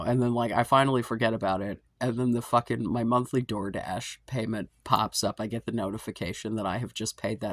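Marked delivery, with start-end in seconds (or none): none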